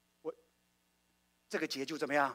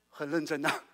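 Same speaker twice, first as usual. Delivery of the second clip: usual speaking voice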